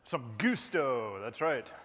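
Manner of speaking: boisterous, excited. Shown as a demonstration. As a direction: talk it up with real gusto. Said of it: "gets really excited and talks something like this".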